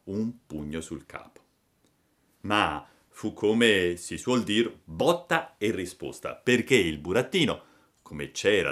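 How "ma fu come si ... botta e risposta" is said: ordinary delivery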